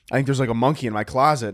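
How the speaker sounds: ordinary delivery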